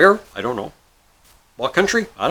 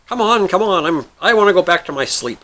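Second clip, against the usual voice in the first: higher-pitched hurrying plea; impatient pleading; 7 semitones higher, 4 dB louder than usual. Acted. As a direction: urge him on, wanting to be done. Genie impatient to go back.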